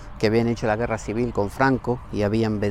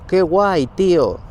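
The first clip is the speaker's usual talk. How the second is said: loud, high gush of Spanish slang; mock gushing slang; 6 semitones higher, 8 dB louder, much slower than usual. Admiring the mountain in mock slang. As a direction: gush it like a shallow kid. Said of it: "miro el Monte Naranco ... y digo".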